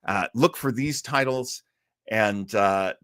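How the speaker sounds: usual speaking voice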